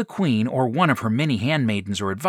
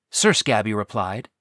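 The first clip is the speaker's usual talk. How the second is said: plain reading voice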